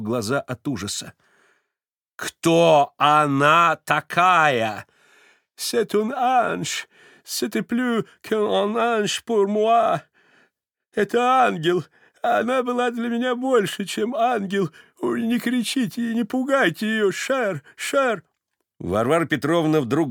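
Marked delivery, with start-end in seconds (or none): 2.2-4.8 loud, very slow jealous demand. A narrator's reading. Varvara demands to know who the woman is, jealous. 5.6-18.2 put-on high, pleading old man; frightened pleading. A narrator's reading. Stepan begs Varvara not to scare the woman, frightened.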